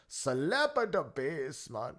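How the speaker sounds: slow, lilting leprechaun voice; Irish leprechaun lilt, drawn out. Pretend. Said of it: "make me sound like a leprechaun".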